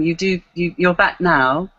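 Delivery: normal talking voice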